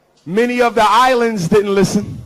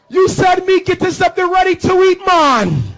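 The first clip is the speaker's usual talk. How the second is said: Jamaican patois; 9 semitones higher than usual. Retold or imitated.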